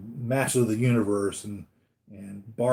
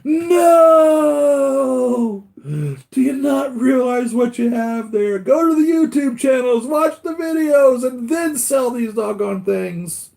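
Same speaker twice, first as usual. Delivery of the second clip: high, loud, drawn-out mock ghostly wail; mock scream from the grave; 14 semitones higher, 10 dB louder, much slower than usual. Dead collector screaming at heirs about his figures, hammed-up. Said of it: "screaming from the grave".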